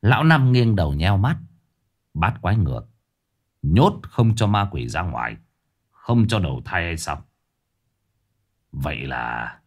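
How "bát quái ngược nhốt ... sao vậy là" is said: lower-pitched musing; pondering old exorcist. Acted. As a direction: repeat it thoughtfully as the old man. (giọng ông thầy già trầm, suy ngẫm)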